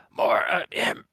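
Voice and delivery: loud urgent whisper; whisper yelling. Acted. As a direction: hiss it out like a shouted secret. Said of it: "I'm going to do the whisper yell".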